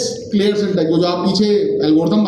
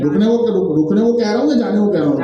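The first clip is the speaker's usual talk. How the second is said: normal talking voice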